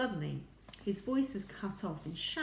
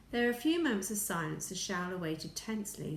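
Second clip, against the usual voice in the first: plain reading voice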